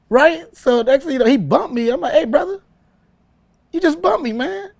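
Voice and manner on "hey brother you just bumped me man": much higher-pitched indignant retelling; acted confrontation. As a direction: call him out in a high, aggrieved voice. Acts out confronting the bodyguard.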